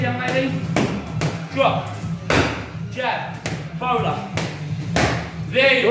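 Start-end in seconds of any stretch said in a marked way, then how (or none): 5.5-5.9 loud burst of praise; excited praise. Real, not acted. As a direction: shout it out pleased. Loud praise after good shot.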